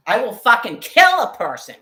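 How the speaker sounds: loud, high-pitched real fury; angry shouted threat. Real, not acted. Woman raging about being harassed on walks, shouted threat.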